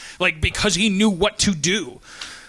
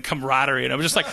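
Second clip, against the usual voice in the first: ordinary talk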